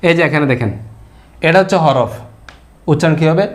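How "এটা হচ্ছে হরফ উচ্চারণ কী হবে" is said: ordinary delivery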